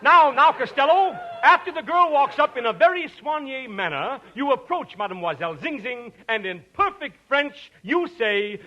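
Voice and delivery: ordinary talk, nothing marked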